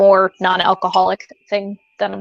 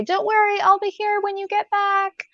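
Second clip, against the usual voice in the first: friendly reassurance; 11 semitones higher than usual. Retold or imitated. Clerk reassuring a customer heading to the car, high and sweet. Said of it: "I say".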